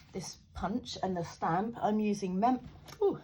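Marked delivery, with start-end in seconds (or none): 1.8-3.2 talking with loose dentures. Real, not acted. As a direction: teeth slipping out while talking. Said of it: "Put my teeth back in".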